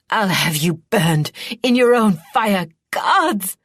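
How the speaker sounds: loud scream rising high on gods. A narrator's reading. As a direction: scream the threat at her. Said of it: "she screamed".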